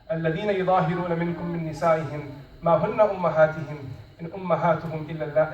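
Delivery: rapid, low Quranic recitation. Shown as a demonstration. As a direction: recite the ayah in a fast, flowing chant. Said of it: "he recited to her the ayah".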